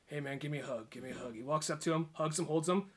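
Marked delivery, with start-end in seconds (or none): 0.1-1.3 much softer, falsely friendly coaxing; menacing softness. Retold or imitated. Trevor to the biker, soft and falsely friendly. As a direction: lure him in quietly before the punch. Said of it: "he just walks away and says".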